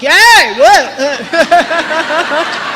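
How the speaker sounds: loud, high fake cheer then a laughing what; hammed-up joy ending in a laugh; mock cheer. Home Depot worker's fake cheer, loud and high.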